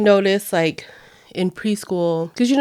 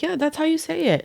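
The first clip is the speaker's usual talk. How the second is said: encouraging mom; 9 semitones higher, 4 dB quieter, faster than usual. Retold or imitated. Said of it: "and I'm like".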